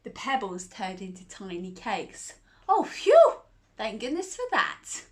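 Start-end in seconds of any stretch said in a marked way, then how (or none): 2.7-5.0 high, loud squeal of relief; a child's bright burst of relief; relieved Alice. Acted. Alice relieved, cakes appear.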